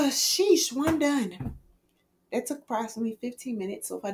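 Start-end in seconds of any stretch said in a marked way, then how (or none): 0.1-1.6 relieved. Real, not acted. Relief after a long task.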